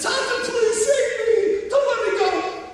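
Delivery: put-on desperate high child's scream, stretched slowly; a little girl screaming for her father to save her; child crying out. A narrator's reading. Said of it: "she cries out".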